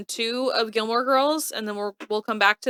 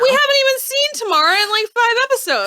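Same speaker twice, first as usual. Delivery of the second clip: indignant; 12 semitones higher, 5 dB louder, faster than usual. Real, not acted. Indignant about Tamara.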